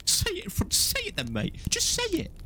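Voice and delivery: very high-pitched goading voice; Dudley impression. Mate daring him to tell everyone, high mock voice. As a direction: dare them in a squeaky put-on voice. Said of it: "He said".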